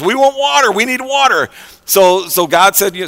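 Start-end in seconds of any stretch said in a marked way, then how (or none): 0.0-1.5 high, insistent mock complaint; complaining. Israelites in the wilderness to Moses, complaining. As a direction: imitate a crowd pleading its need. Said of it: "they're complaining to Moses".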